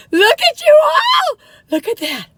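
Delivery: very high, loud, drawn-out cry of delight; excited squeal. Real, not acted. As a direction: squeal it to your viewers. Shows off the stuffed cookie.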